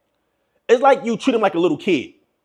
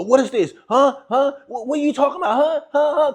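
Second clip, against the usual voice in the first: higher-pitched mock confusion, repeated huhs; baffled questioning; 8 semitones higher than usual. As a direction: imitate someone questioning everything. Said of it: "Question everything like".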